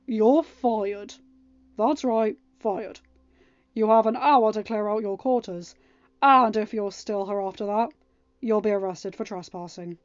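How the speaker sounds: loud, firm royal firing; king firing a servant; stern dismissal. A narrator's reading. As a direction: lay down the law.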